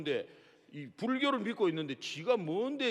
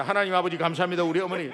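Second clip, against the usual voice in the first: mock phone prayer; 5 dB louder, faster than usual.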